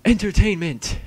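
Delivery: high, loud announcer cry; sudden fake excitement; mock hype. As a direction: sell it like a showman, tongue in cheek.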